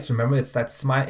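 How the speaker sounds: ordinary talk, nothing marked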